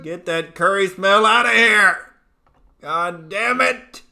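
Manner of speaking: ranting outburst. Real, not acted. Ranting at curry smell.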